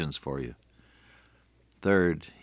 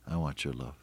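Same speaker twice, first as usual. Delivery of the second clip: much softer echo of the line; quieter repeat; 5 semitones lower, 10 dB quieter than usual. Acted. Preacher voicing Jesus to listeners, soft echo. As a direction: drop to a near hush on the repeat.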